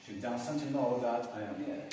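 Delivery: whole sentence joined up, moderately slow; connected read-back. Shown as a demonstration. Teacher reading back the written sentence, connected delivery. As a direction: read it through in one phrase. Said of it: "lo voy a escribir".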